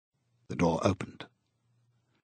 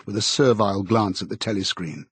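ordinary delivery, nothing marked

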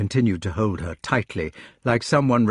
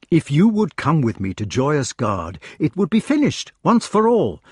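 higher-pitched, urgent persuasion; impassioned pleading; 7 semitones higher, 4 dB louder than usual. A narrator's reading. Lancelot urging Jenny to come to Joyous Gard, impassioned. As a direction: press the case, voice climbing high.

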